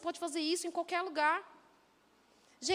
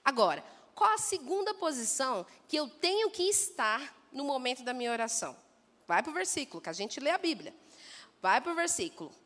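ordinary talk

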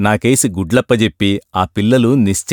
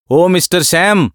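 calling out; 7 semitones higher than usual. A narrator's reading. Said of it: "అని పిలుపు వినబడ్డది".

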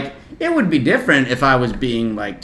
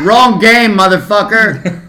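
high, loud jeer; brash ballpark taunt; heckling yell; 8 semitones higher, 8 dB louder than usual. Retold or imitated.

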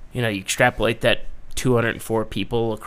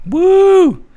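excited whoop; 12 dB louder than usual. Real, not acted.

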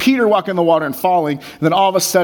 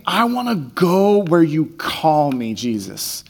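earnest plea; slower than usual. Acted. Peter's earnest plea voiced.

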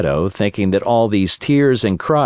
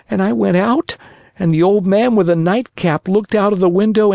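put-on high, lively puppet boy voice; a cheeky wooden boy complaining; Pinocchio voice; 12 semitones higher than usual.

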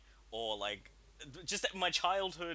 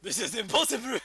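high, loud, laughing hammed-up cry; half-laughing doomed officer; mock movie line; 9 semitones higher, 9 dB louder than usual. Friend quoting a film, laughing mock cry.

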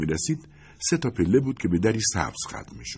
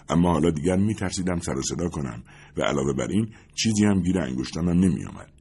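ordinary delivery

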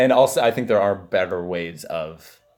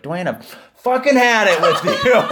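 mock fed-up outburst; 8 semitones higher, 5 dB louder than usual. Imagined friend snapping at him.

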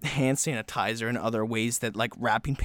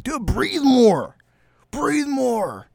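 high, loud, slow know-it-all urging; mock coach advice; 13 semitones higher, 6 dB louder, much slower than usual. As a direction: bark useless advice at someone choking. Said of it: "He's like".

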